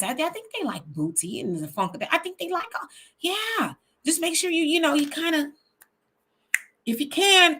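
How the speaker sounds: mock coaching advisor. Advisor coaching Kamala to snap on the beat, mock condescending voice. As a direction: play a patronizing helper schooling her on rhythm. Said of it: "She got them folks telling her how to cosplay".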